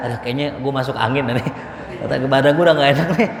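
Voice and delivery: mock-ailing elder begging off; reluctant old person. Old congregant dodging the outing, reluctant and low. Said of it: "Orang tua, Jalan nggak?".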